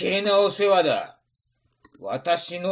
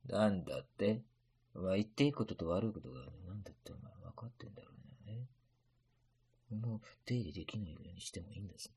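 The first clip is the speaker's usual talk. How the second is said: low menacing threat; 9 semitones lower, 14 dB quieter, much faster than usual. Acted. Samurai threatens merchant quietly.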